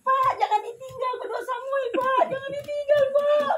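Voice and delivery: high, loud sobbing plea with a laugh in it; crying plea. Retold or imitated. Wife begging her dying husband, crying loudly. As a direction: beg him not to leave, voice breaking high. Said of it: "Saya nangis".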